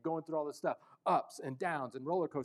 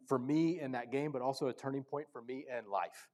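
ordinary delivery

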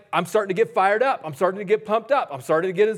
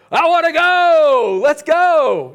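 much higher, louder, slow eager cry; eager kid voice; 10 semitones higher, 9 dB louder, slower than usual. Retold or imitated. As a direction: beg to go like an excited kid. Voicing son eager to go.

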